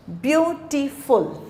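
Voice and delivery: Beautiful slowly in three beats, ब्यू-टी-फुल; syllable by syllable. Shown as a demonstration. Teacher asking how many vowels, beautiful split into view, टी, फुल. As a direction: separate each syllable of beautiful evenly. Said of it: "इसमें कितने आ रहे हैं?".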